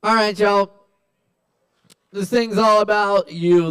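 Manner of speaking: rally the crowd; host call-out. Real, not acted.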